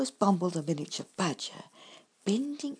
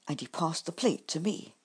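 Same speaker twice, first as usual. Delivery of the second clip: usual speaking voice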